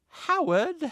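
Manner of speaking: high, loud, rising mock American call; Perry, bad American accent. Host pretending to be a companion, bad American accent. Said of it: "pretending to be Perry doing a bad American accent".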